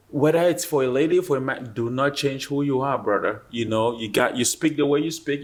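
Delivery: usual speaking voice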